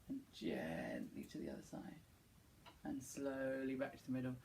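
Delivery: slow, hushed warm-up voice; soft calm guidance. Acted. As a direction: ease them gently, slowly. Leads slow gentle warm-up.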